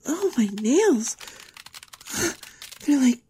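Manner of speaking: high, halting, startled voice; rising alarm. Acted. Girl seeing her nails change, alarmed. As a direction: gasp between broken phrases as it spreads. Body begins transforming, alarmed.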